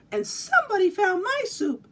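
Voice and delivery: a tearful little cub wailing over lost soup; baby bear crying. Acted. Baby bear's soup eaten.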